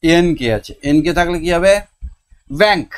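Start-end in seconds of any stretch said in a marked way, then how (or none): 2.6-2.9 ব্যাংক said higher and louder, with the nk ending made clear. Shown as a demonstration. Teacher showing pupils the nk ending: ব্যাংক higher and louder. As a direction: sound the nk in ব্যাংক, raised and loud. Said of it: "ইওতে কয়ে উচ্চারণ হয়".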